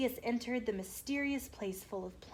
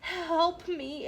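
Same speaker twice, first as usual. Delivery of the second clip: higher pleading dragon voice; 7 semitones higher, 4 dB louder than usual. A narrator's reading. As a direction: beg for help as the dragon. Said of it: "it pleaded".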